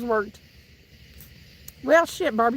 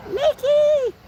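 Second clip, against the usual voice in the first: high, loud shout of the name; loud call; 12 semitones higher, 5 dB louder than usual. Real, not acted. Calling Nikki from another room, loud and high. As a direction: call her from the other room.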